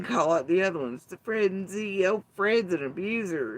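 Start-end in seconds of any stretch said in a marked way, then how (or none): 2.1-3.6 higher preachy mock accusation; mocking quote. Mimicking Six Up accusing Fred, high sneer. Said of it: "says Six Up in curiosity".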